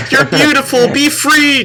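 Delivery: high, loud, hammed-up exaltation; mock liberating cry. Imagined rescuer to the girl in hijab, mock ecstatic. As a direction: proclaim it high like a mock saviour.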